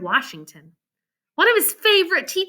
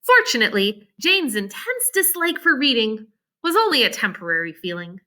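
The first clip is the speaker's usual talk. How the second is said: usual speaking voice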